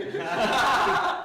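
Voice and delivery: loud, high burst of laughter; real hearty laughter; big laugh. Real, not acted. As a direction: crack up helplessly. Laughing at crackhead joke.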